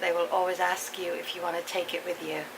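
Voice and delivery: ordinary talk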